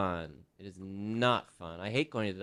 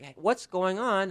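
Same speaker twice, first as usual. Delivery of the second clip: higher, puzzled ask at the desk; asking at the counter; 8 semitones higher than usual. Retold or imitated. Deaf patient asking the front counter why she's still waiting, pitched up. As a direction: call over to staff, a little worried. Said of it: "I'm like".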